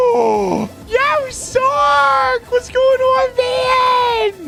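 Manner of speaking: very high, drawn-out hollered greeting; hyped shout. Pretend. Streamer welcoming a viewer to the chat, hyped shout. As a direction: holler a huge welcome, stretching the words out.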